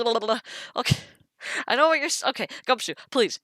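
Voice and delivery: usual speaking voice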